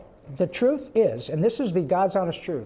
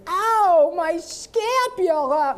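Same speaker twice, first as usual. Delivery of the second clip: very high, slow cartoon whine; Carl's scapula line; 11 semitones higher, 6 dB louder, much slower than usual. Carl complaining of his injury, high slow whine. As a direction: drag out "scapula" like a hurt nerd. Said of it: "But if you say".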